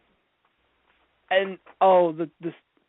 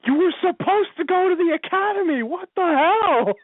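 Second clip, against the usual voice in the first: high, loud mock-outraged yell; outraged dad; 12 semitones higher, 4 dB louder than usual. Imagined dad scolding his son about the academy, mock outraged. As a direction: blow up like an exasperated dad, hammed up.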